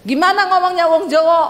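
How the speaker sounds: high-pitched challenge; challenging retort. Acted. Wife asking husband how Javanese talk, high retort. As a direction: throw the question back high.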